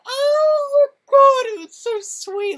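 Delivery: high, wailing mock sob; mock crying. Fan retelling the hug scene, high mock cry. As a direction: squeal it like you're tearing up. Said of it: "and I'm like".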